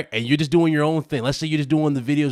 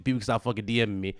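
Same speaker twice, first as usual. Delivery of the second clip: normal talking voice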